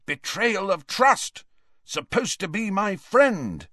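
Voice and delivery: high, held-back mock shout; imagined shouting. Ron catching Harry in his daydream, shouting accusingly. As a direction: cry betrayal, pitched high without full volume. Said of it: "shouting things like".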